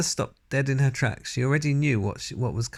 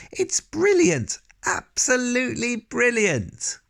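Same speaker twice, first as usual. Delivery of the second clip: much higher, louder and slow, bursting with glee; delighted shout; 13 semitones higher, 3 dB louder, slower than usual. A narrator's reading. Grandmother praising the boy's cat plan, overjoyed shout. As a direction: crow it out like a thrilled old woman. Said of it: "a great grin spread over her face and she shouted".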